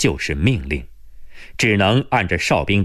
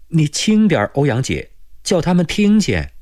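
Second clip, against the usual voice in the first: trembling voice; 8 semitones higher than usual. A narrator's reading. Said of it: "颤着声音说".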